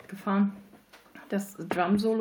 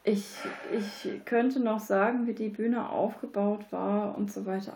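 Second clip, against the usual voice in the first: normal talking voice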